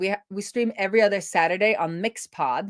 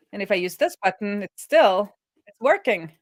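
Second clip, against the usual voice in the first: normal talking voice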